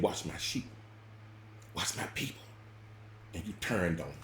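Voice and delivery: soft, unvoiced words from God; hushed voice of God; whispered command. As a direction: breathe it out as a whisper. Voicing God's command.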